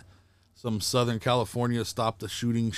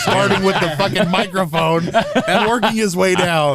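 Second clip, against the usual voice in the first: laughing announcer; 6 semitones higher, 7 dB louder than usual. Acted. Joke show intro while laughing.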